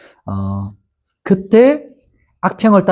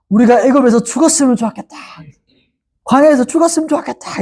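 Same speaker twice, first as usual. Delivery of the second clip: high, fast whining grumble; whining complaint; 7 semitones higher, faster than usual. Retold or imitated. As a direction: complain like grumbling Israelites, pitched high and hurried. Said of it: "어떻게 원망하냐면".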